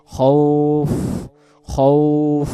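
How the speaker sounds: খৌফ ending in a breathy ফ; breathy final ف demo. Shown as a demonstration. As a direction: let air out on the ফ of খৌফ. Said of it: "শেষে বাতাস বেরো খৌফ". (খৌফ শেষে ফ-তে বাতাস ছেড়ে)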